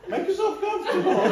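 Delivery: talking through a big real laugh, pitch up high; cracking up while inviting them to sit. Real, not acted. Celebrant laughing as the couple settles in.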